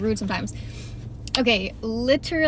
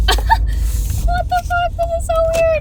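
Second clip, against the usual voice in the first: very high, thin squeal of disbelief; mock freaked-out aside. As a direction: squeak it out like a private thought. Retold inner reaction to bickering.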